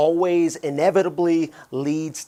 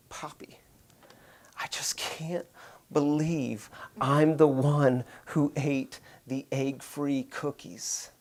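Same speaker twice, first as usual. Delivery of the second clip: slow, breathless whispered confession with tears; retold crying whisper; 6 dB quieter, slower than usual. Daughter in tears under the covers confessing, whispered. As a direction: barely voice it, a tearful child.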